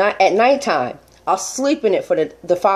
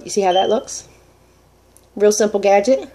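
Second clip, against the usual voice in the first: ordinary talk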